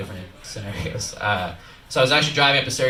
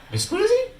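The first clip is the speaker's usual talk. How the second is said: high, hammed-up Italian "Mi scusi". Comedian plays along with the cop, high mock Italian. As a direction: pop it up high like a fake Italian. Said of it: "And I was like".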